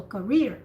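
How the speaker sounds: career, ka barely heard, rear strong; stress on rear. Shown as a demonstration. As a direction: keep ka small in career. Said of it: "don't sound the first syllable".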